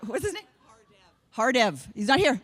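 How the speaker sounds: ordinary talk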